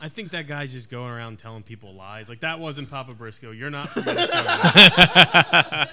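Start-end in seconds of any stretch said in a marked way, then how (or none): 2.4-5.9 mock liar voice. Mocking the lying fan.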